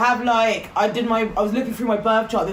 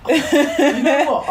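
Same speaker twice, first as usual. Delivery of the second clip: laughing; 5 semitones higher, 5 dB louder, much slower than usual. Real, not acted. Laughing reaction to friend.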